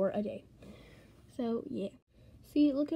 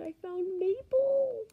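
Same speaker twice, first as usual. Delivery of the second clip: high, slow squeal of delight; excited; 10 semitones higher, slower than usual. Real, not acted. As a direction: squeal the name with joy. Excited find of favourite villager.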